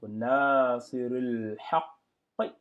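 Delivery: nasirul haqq drawn out slowly; slow, loud Arabic reading. Shown as a demonstration. Teacher reading the final phrase for students. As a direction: hold each sound of nasirul haqq. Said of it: "Et enfin, on lit le qi".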